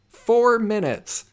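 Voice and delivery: FOUR MINUTES stretched slow, high and loud; exasperated emphasis. Real, not acted. Teller stressing how close he got to the office, exasperated.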